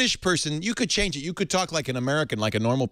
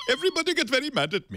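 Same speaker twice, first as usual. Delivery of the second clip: mock Indian accent, pitched up toward the end; 10 semitones higher than usual. Host showing the accent that gets him in trouble, comic Indian accent. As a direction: put on an Indian tech-support voice. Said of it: "Whenever I do the Indian guy".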